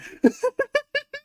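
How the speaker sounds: high villain cackle; Joker laugh. Acted. Joker gloating over Batman, high cackle. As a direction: cackle like a gleeful madman. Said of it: "as he continues to laugh".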